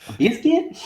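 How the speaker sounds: high incredulous Southern question; mock a puzzled Southern cashier; Southern lady. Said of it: "She's like".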